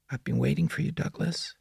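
put-on high, soft, eerie child voice; prepubescent boy. A narrator's reading. The boy in the woods greets Douglas, eerie and childlike. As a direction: speak softly up high, like a creepy little boy. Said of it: "his phlegmy, prepubescent voice".

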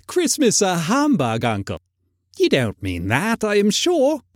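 high, swooping, incredulous nephew; lilting, good-natured disbelief; cheerful nephew. A narrator's reading.

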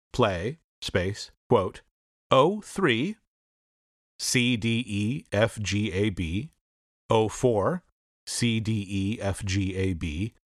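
spelling out code, fading low on runs; dictating. Shown as a demonstration. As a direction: list symbols with falling runs. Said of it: "For example".

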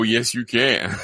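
ordinary talk

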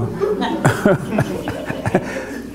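breathy laugh breaking off the repetition; amused laugh. Real, not acted. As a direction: laugh at your own endless "ever". Laughs mid "never ever.

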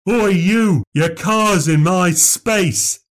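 put-on high, slow, belligerent old man's shout; angry old drunk. A narrator's reading. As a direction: bellow it at the young newcomer. Old drunk confronts Christopher.

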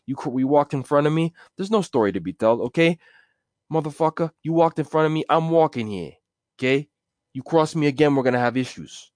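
high, tough New York threat; mobster threat. Acted. Mobster threatening a man who crossed him, tough voice. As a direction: warn him off like a wiseguy.

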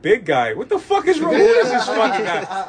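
loud, high-pitched incredulous outburst; exasperated disbelief. Retold or imitated. Customer reacts to being called big guy, loud comic outrage.

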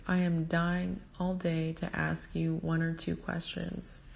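ordinary delivery

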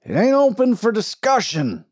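loud, high bellow. A narrator's reading. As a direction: bellow it at the crowd.

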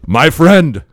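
loud, hearty mock African accent; terrible African accent. Drunk hugger greeting his friend, loud, badly imitated African accent. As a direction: boom it out in a bad African accent. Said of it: "I'm doing his accent terrible".

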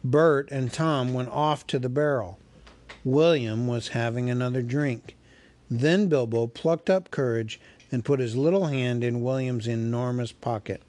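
ordinary delivery, nothing marked

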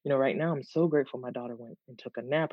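normal talking voice

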